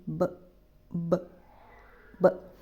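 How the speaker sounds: ब said short, without a trailing बा; short ब kept in the mouth. Shown as a demonstration. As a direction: clip ब off inside the mouth. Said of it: "मुंह के अंदर ही आवाज़ रहेगी". (ब को मुंह के अंदर ही छोटा बोलें)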